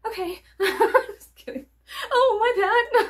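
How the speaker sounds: high, laughing apology; laughing meekly. Retold or imitated. Apologizing to the store, high and laughing. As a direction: giggle out a sheepish apology. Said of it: "And I'm like".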